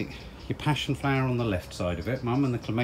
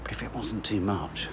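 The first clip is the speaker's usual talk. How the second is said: whispering; 3 semitones lower, 8 dB quieter than usual. Real, not acted. Private aside while filming.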